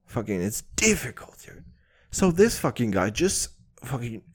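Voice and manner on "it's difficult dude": ordinary talk, nothing marked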